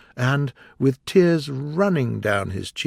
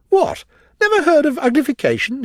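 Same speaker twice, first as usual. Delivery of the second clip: much higher, louder astonished cry; exclaiming in surprise; 7 dB louder than usual. A narrator's reading. Gryphon astonished at Alice, loud high exclaim. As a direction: shriek it out in disbelief. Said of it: "it exclaimed".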